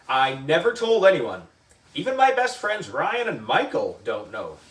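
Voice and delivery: usual speaking voice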